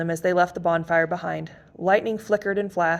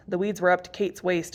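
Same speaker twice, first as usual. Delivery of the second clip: usual speaking voice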